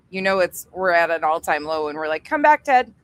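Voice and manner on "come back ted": pleading call. Pretend.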